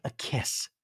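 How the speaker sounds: breathy whispered "A kiss", meek and small. Retold or imitated. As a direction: murmure tout bas, gênée. Quoting Taylor's meek answer.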